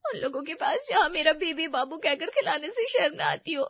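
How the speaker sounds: high, whiny crying retort; wounded girlfriend whine; tearful sulk. Acted. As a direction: throw it back, hurt and weepy. Hurt girlfriend answers tearfully.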